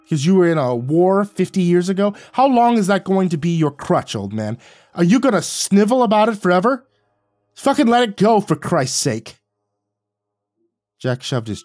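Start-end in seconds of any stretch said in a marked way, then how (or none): 0.0-9.4 loud, high contemptuous yelling; taunting shout. A narrator's reading. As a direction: shove every word at him. Narrator taunting Jack in a fight.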